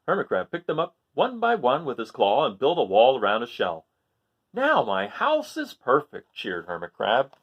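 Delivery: lively picture-book reading, rising on each list item; story narration. A narrator's reading. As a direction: read it to children, animated and sing-song. Reads picture book aloud.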